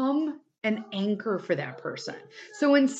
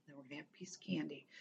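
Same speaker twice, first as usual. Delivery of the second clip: breathy whispered comfort; soothing whisper; 5 semitones lower, 21 dB quieter, much faster than usual. Shown as a demonstration. Offering a friend candy in crisis, hushed whisper. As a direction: whisper it like calming a scared friend. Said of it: "strike the soothing".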